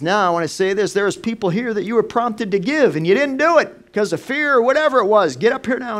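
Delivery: loud, high, urgent pulpit call; preacher's altar call. Retold or imitated. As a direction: call them up with full force. Pastor retells preacher's altar call.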